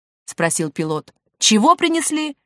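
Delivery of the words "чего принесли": high-pitched baffled question; astonished query; incredulous. Acted. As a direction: ask in disbelief, pitched up. Pilot asks again, incredulous.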